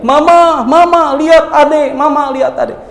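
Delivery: high panicked scream for mama; child screaming. Acted. Boy screaming to his mother after the killing, high panicked cry. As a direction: shriek it high like a terrified child. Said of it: "dia teriak".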